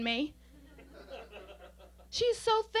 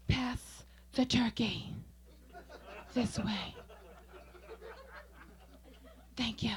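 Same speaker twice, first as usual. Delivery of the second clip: each word drawn out and deliberate; slow showing off; 3 semitones lower, slower than usual. Acted. Showing off nails at Christmas dinner, slow showy request. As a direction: stretch a simple request for maximum display.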